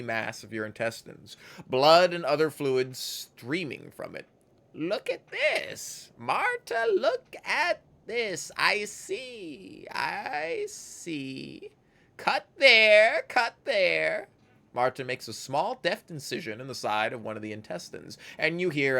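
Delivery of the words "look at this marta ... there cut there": very high, slow sing-song crone; gleeful, creepy crone voice. Acted. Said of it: "in a strange sing-song voice".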